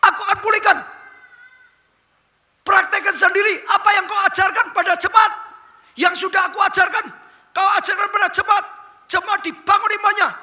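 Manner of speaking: high, loud booming challenge; God's commanding voice. Acted. God challenging the retired pastor, loud high booming command. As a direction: thunder it down as God speaking to his servant. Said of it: "kata Tuhan".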